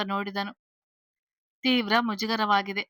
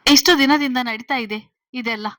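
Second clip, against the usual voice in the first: loud probing question; 2 semitones higher, 8 dB louder than usual. A narrator's reading. Father quizzing the daughter's boyfriend, loud probing question. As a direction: raise the voice, quizzing. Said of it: "ಹುಬ್ಬು ಹಾರಿಸಿ ಕೇಳಿದರು".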